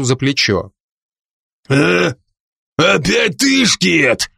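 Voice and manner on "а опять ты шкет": put-on higher-pitched, aggressive drunken growl. A narrator's reading. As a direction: snarl at the young meddler. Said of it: "прорычал Деми". (пьяное злобное рычание)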